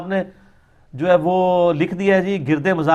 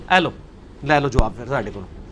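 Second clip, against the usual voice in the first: mocking taunt; 8 semitones lower, 4 dB quieter than usual. Taunting the opponents in Punjabi.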